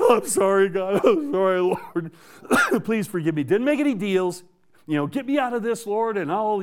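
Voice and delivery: high, hammed-up tearful pleading; imitated crying. Trucker crying to the Lord in his sleeper, mock tearful plea. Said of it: "I'm back there crying".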